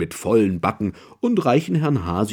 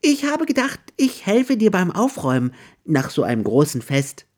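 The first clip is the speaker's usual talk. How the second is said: high, hesitant little hare voice; timid; 9 semitones higher than usual. A narrator's reading. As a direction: offer help shyly, a hint of nerves. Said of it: "sagt er zaghaft".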